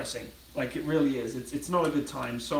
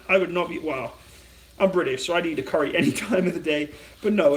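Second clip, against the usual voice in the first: ordinary talk, nothing marked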